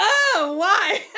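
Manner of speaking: real laughter, high and loud; laughing. Real, not acted. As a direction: lose it laughing. Laughing break between takes.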